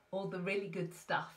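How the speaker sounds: ordinary talk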